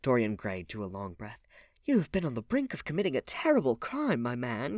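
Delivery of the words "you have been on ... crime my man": high, loud stern scolding; lofty reprimand; stern reproof. A narrator's reading. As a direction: reprove him sternly, voice raised. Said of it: "looking at him sternly".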